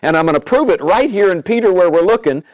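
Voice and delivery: preacher building to a shout of victory; rising preaching fervor. Real, not acted. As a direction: lift it up in triumph.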